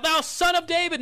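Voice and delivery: high, loud pleading call; yelling out. Retold or imitated. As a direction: shout it high over the crowd. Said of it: "they're just yelling out".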